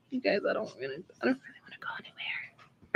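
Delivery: hushed whisper to the camera. Real, not acted. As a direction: whisper it secretly.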